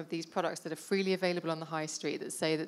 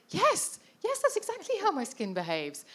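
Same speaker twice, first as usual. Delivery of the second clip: excited recognition; 12 semitones higher than usual. Retold or imitated. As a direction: re-enact a thrilled moment of being understood. Re-enacting her delighted moment of recognition.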